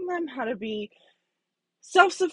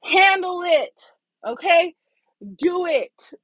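louder, higher, put your foot down; insist, no more discussion; firm command; 4 semitones higher, 5 dB louder, slower than usual. Retold or imitated. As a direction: boss ending the debate, firm and raised. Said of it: "put my foot down".

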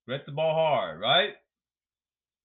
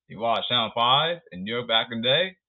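normal talking voice

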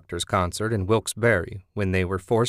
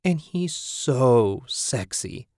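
slow, swooning fangirl gush; lightly voiced swooning roommate; dreamy squeal; 4 semitones higher, much slower than usual. A narrator's reading. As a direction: sigh it out over a crush. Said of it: "she'd squeal".

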